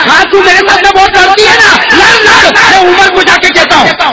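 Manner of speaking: loud, high furious shout at his wife; angry husband. Retold or imitated. Husband shouting at his wife before storming off, furious. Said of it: "تو husband غصے میں نکلا".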